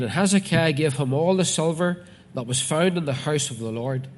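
loud, declaimed Bible reading; preacher reading out scripture. Acted. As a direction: proclaim the verse firmly to the congregation. Reads scripture aloud to congregation.

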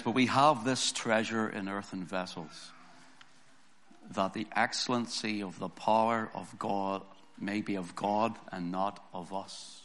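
ordinary talk, nothing marked

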